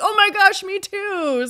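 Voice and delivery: light up at finding a kindred spirit; excited surprise. Retold or imitated. Delighted shared discovery.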